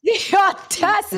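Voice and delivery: high-pitched theatrical indignation; mock outrage. Woman berating a cheating man, mock outrage. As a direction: exclaim it, scandalized.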